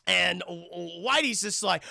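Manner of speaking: usual speaking voice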